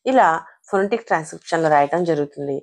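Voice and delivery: normal talking voice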